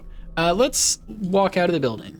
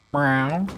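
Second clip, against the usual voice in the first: lower, short rising meow; low cat meow; 3 semitones lower than usual. Pretend. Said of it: "Meowing brought out all of the kitties".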